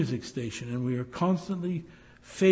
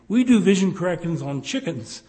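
louder, proud ad announcement; proudly; 4 semitones higher, 9 dB louder than usual. Retold or imitated. Preacher mimicking the ad lady proudly announcing. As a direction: boast it like a spokeswoman. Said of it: "she says proudly".

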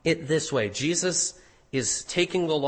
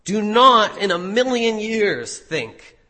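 higher, louder, insistent "Do not in a million years think"; "Do not in a million years think" as a forceful, raised denial; very emphatic; 4 semitones higher, 7 dB louder than usual. Retold or imitated. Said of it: "makes it very emphatic".

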